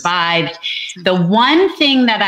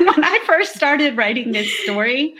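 high-pitched, giggly retake of the opening; laughing restart; 8 semitones higher than usual. Real, not acted. Restarts laughing after joking about challenge.

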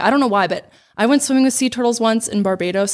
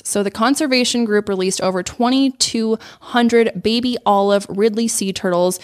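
normal talking voice

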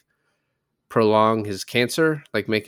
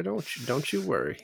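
laid-back Superman; 4 semitones higher, 10 dB quieter than usual. Pretend. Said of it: "Just a laid-back Superman".

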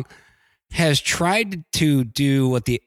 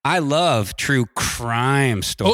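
slow, drawn-out emphasis on CRIME; air-quote intonation; much slower than usual. Shown as a demonstration. Attempting it without moving hands, slow air-quote intonation. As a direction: stretch the words as if in air quotes. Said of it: "inflection or intonation without doing the air quotes".

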